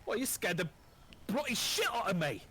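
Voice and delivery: a lightly voiced Scottish dwarf telling him off; Scottish accent. Dwarf scolds the player for scaring him, light Scottish accent. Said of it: "my Scottish accent".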